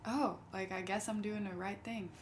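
ordinary talk, nothing marked

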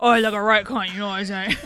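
mock British film geezer; put-on British crook patter; British gangster accent. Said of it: "like, a Guy Ritchie directed".

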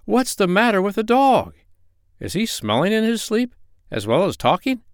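high-pitched, puzzled questioning; high, incredulous character voice; astonished doctor. Acted.

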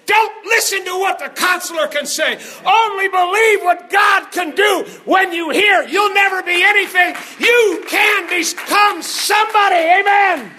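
high, loud, building rallying shout; impassioned preaching. Real, not acted. Preacher urging the congregation to believe God, impassioned high shout. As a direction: drive it home to a full-force amen.

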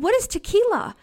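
high, loud incredulous ask; shocked question. Retold or imitated. Mother asking her four-year-old, shocked and loud. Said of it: "And I said".

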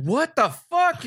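high, drawn-out incredulous outburst; exasperated disbelief. Retold or imitated. Fan reacting to a stupid film scene, exasperated disbelief. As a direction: pitch it way up in baffled protest.

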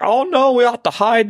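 high-pitched mock Cockney; Cockney accent. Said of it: "like, a Cockney accent".

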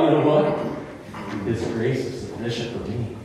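quiet sincere promise of grace; tender assurance. Acted. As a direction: lean in and comfort them gently. Drops to soft assurance.

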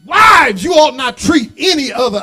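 loud, high preaching cry; preaching shout. Real, not acted. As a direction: call it out to the whole church.

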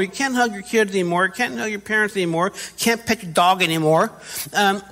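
ordinary delivery

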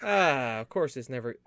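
ordinary talk

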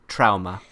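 "trauma" said as "trow-ma"; put-on "trow-muh" for "trauma"; Jamie Lee Curtis impression. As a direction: imitate an odd "trauma", first vowel as in "cow". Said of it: "as Jamie Lee Curtis likes to say".

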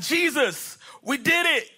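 high, gleeful mock triumph; excited disciples. Disciples running back to Jesus after the mission, high excited report. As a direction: burst in high-pitched with proud news. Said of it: "you're so excited".

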